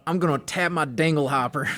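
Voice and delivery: put-on thick Southern accent, playful quote. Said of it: "somebody with a thick Southern accent going".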